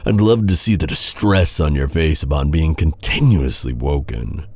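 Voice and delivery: usual speaking voice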